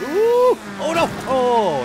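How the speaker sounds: sudden high, loud shriek of alarm; startled yelp. Real, not acted. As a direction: yelp at a near miss. Near crash in the race.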